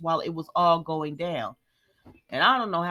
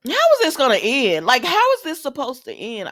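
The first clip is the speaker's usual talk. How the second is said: loud, high exasperated demand; real exasperation, pitched up and loud; exasperated outburst; 7 semitones higher, 9 dB louder than usual. Real, not acted. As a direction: cry it out in exasperation. Exasperated at the storyline.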